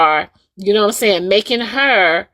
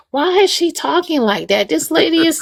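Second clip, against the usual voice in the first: high, squealing mock disbelief; mock outraged listener; 7 semitones higher than usual. Speaker mimics listeners reacting to Diamond, high mock outrage. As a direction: play a stunned fan asking why she talks like that. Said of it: "the first thing y'all will say is".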